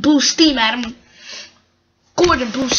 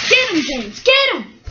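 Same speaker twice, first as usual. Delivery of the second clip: excited call leaping higher; urging shout; 6 semitones higher than usual. Acted. Urging James after the runaways, calling out. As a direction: egg them on, pitch climbing.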